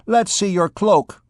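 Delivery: a hint of a coarse wench demanding; rough wench. Big wench demanding Gringoire's cloak, lightly voiced.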